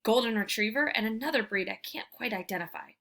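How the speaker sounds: ordinary delivery, nothing marked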